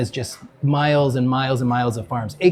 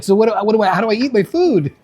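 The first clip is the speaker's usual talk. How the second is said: higher, half-laughing mock alarm; mock panic; 6 semitones higher, 4 dB louder, faster than usual. Friend panicking at a New York restaurant, in mock alarm. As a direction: fake a rising panic over dinner. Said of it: "Panic starts to set in".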